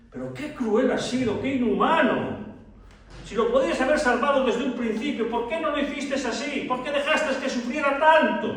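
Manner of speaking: high-pitched, heated accusation; pleading, scandalized questions pitched high; indignant reproach. Acted. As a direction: confront him with rising outrage. Pastors reproach Pedro in retold story.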